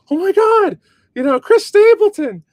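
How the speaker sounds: high, loud shriek of fake recognition; imitated fan shout. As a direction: shout it out like a star-struck fan in a crowd.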